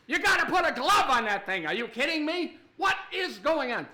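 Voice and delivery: loud, high-pitched mock shock; hammed-up incredulous yelling; outraged disbelief. Visiting chef catching cook's filthy habit, outraged disbelief. Chef horrified by surveillance, acted.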